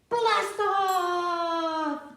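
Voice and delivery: big high shout of blast off. Shown as a demonstration. Teacher shouting the launch for the kids. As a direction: yell it like the rocket launches. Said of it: "we're gonna do that again".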